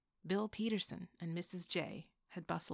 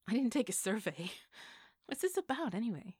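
laughing question; 5 semitones higher, 6 dB louder than usual. Acted. Sister laughing, asking why.

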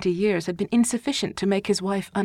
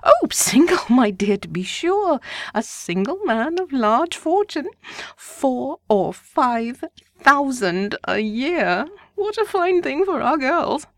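much higher, louder, slowed delight; delighted wife; 7 semitones higher, 6 dB louder, slower than usual. A narrator's reading. Wife thrilled about rich bachelor, higher drawn-out delight. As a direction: savour every word of the fortune.

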